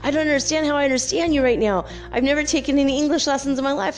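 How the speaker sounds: high, rapid flustered babble; mock panic. Man who never took English lessons, mock panicked rush. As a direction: rush it out high, imitating someone freaking out. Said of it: "he got really panicked".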